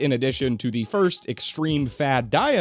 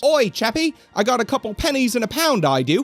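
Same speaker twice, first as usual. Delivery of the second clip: much higher, hammed-up British accent; mock British accent; 7 semitones higher than usual. Host mocking British slang in a much higher, hammed-up British accent. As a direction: lay the British accent on thick and silly. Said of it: "just imagine a sentence".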